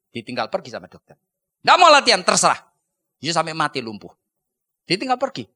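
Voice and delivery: loud stern doctor telling him off; doctor warning the boy he'll stay lame, loud and stern; stern scolding. Retold or imitated. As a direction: scold the lazy patient.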